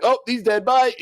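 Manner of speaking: high, loud mocking write-off; mock breezy dismissal. Host mocks the X-Men abandoning Morph, loud flippant dismissal. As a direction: toss off a careless farewell, loud and high.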